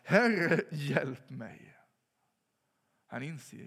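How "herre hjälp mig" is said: sudden loud cry on Herre, then dropping; crying out for help. Retold or imitated. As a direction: call out loud, desperate, sinking. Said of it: "och så ropar han".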